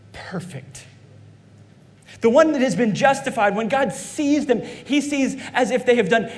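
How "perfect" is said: breathy whisper, nearly no voice; hushed whisper. Acted. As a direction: let the word fall to a whisper. Whispered climax in courtroom image.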